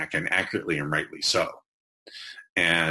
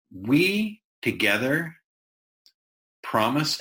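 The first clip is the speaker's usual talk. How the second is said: "we", "together", "promise" each set apart, much higher and rising; stressed key words; 7 semitones higher, slower than usual. Shown as a demonstration. Teacher highlighting relationship words to students, each one high and rising. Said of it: "are what I call implied relationship words".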